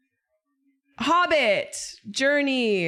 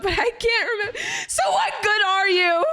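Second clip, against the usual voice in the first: laughing outburst; 11 semitones higher, 4 dB louder than usual. Real, not acted. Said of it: "laugh cry".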